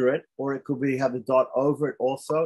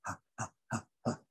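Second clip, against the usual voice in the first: softer, breathy panting ha sounds; mock panting; 12 dB quieter, much faster than usual. Shown as a demonstration. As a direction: pant as if out of breath after running. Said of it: "I'm out of breath".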